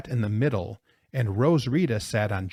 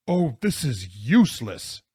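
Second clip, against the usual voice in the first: acted exasperated gasp; gasped complaint. A narrator's reading. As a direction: blurt it out as if winded. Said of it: "Jonathan gasped".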